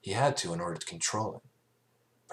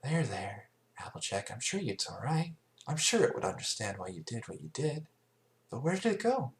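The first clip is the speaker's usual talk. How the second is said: gentle Fluttershy; 6 semitones higher than usual. Acted. Fluttershy comforting Applejack.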